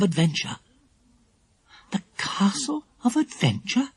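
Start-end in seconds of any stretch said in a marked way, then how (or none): 1.9-3.9 high, hushed, questioning echo; surprised echo. A narrator's reading. Lucy Ann questioning the castle's name, surprised. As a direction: repeat the name in high-pitched wonder. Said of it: "echoed Lucy Ann in surprise".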